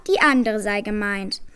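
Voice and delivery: usual speaking voice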